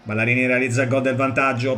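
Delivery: normal talking voice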